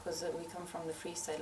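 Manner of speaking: ordinary talk, nothing marked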